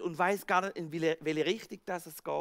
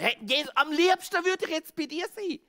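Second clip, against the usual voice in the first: much higher and louder, jubilant; joyful Peter; 9 semitones higher, 5 dB louder than usual. Acted. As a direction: cheer out to Jesus. Peter rejoices.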